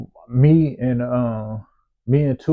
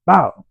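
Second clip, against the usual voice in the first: slap sound effect; 5 semitones higher, 6 dB louder than usual. Retold or imitated.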